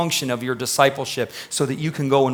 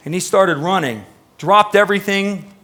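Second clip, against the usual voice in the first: ordinary talk, nothing marked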